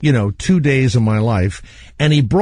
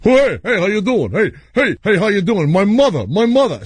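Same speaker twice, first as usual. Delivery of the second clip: high-pitched, punchy repeated greetings; agitated comic impersonation, pitched up; Rodney Dangerfield; 7 semitones higher than usual. Acted. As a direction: imitate the veteran comic in a higher voice. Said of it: "here comes Rodney Dangerfield".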